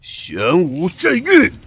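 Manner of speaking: drawn-out spell chant; slow incantation. Acted. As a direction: intone the spell name slowly. Casting a technique.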